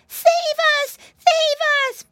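very high, loud, squealed plea; begging. A narrator's reading. Flop caught by the bear, begging for rescue. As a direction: beg loudly in a high piggy squeal. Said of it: "begged Flop".

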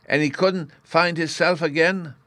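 put-on high, loud little boy's question; childlike, lively puzzlement; piping up as a curious small child; curious Bruno. A narrator's reading.

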